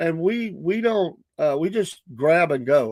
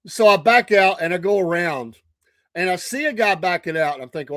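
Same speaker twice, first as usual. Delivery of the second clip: ordinary talk, nothing marked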